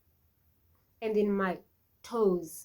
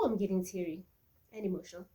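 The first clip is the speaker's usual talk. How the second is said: ordinary talk, nothing marked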